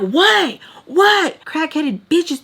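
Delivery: high, incredulous outburst; mad. Retold or imitated. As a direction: lash out at the nosy neighbours. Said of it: "I get mad".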